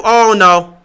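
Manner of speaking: ordinary talk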